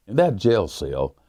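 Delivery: plain reading voice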